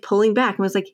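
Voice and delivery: usual speaking voice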